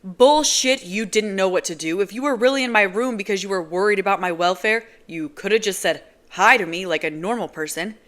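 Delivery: loud, scornful rant; angry retort. Acted. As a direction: tear into her story. Kenji berating Nazira.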